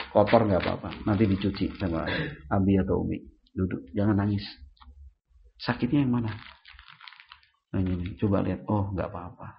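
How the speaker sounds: low, soft comforting parent; acted reassurance. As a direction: quietly reassure a child who fell. Parent reassures fallen child. (menenangkan anak dengan suara rendah dan lembut)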